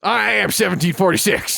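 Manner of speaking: mock patriot declaration.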